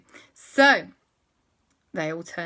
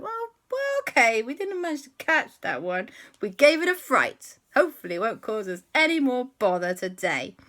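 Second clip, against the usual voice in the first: relieved excited; 6 semitones higher, 7 dB louder than usual. Acted. Kids relieved after scare.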